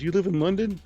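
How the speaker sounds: lightly acted excited local fan; imitated fan. Host retells a fan asking where he lives, imitated fan voice. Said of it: "He goes".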